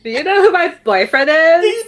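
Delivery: high, loud entitled brag; spoiled rich girl. Acted. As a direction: play the stuck-up girlfriend. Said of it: "hearing [name] do that voice".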